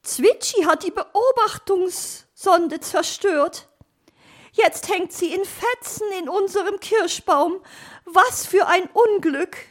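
high, dismayed bird character; lamenting magpie. Acted. Magpie laments the destroyed probe, high dismayed voice. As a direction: wail the complaint in a high voice.

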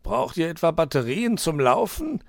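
high fox voice, puzzled question; baffled. A narrator's reading. Herr Fuchs asks the pair about batteries, baffled. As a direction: ask in high-pitched amazement. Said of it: "war der Fuchs verblüfft".

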